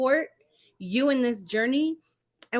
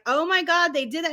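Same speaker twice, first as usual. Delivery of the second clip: mock amazement; 8 semitones higher than usual. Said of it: "everyone's like".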